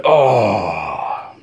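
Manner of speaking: long falling "ohhh"; crowd's groaning "oh". Retold or imitated. Whole room reacting as the counselor misses, imitated crowd groan. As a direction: imitate a roomful of people groaning at a miss. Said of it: "the whole room said".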